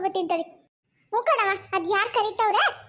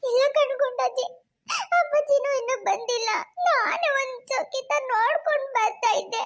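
acted weepy whine; tearful pleading; 3 semitones higher than usual. Daughter pleads with granny to let her go, crying. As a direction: plead with her through tears. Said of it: "ಸುಮ್ಕಿರು, ಅಳ್ಬೇಡ".

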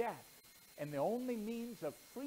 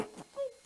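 snicker. Acted. [name] snickers in background.

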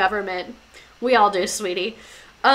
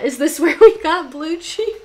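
higher, louder, cracking with laughter; laughing question; 5 semitones higher, 4 dB louder than usual. Real, not acted. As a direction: giggle through the joke. Laughing joke about cheese.

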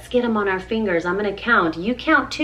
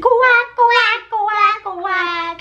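high, louder duck quack; mama duck quack; 9 semitones higher, 4 dB louder, much slower than usual. Acted. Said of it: "Mother duck said".

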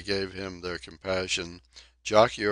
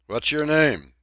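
called out higher and louder across the forecastle; crying out; 8 semitones higher, 9 dB louder than usual. Acted. Sailor crying out at Donkin, loud and high. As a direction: shout the question at the newcomer. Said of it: "Someone cried at him".

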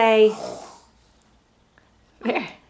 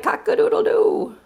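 very high crowing rooster call; 13 semitones higher, 4 dB louder, faster than usual. Shown as a demonstration. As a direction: crow it like a rooster. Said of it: "What's a rooster say?".

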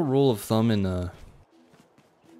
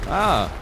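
startled yelp; 7 semitones higher than usual. Real, not acted. Attacked suddenly.

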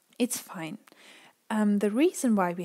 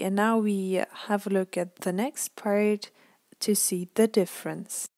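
usual speaking voice

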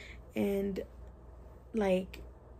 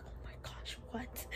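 breathless whispered disbelief; barely a whisper, in shock; stunned whisper; 2 semitones higher, 13 dB quieter than usual. Retold or imitated. Her stunned reaction retold.

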